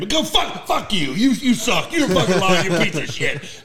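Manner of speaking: high, loud comic tirade; sudden mock outburst. J C answers an apology, hammed-up angry outburst. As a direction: flip from acceptance into sputtering high-pitched abuse. Retold blow-up after accepting apology.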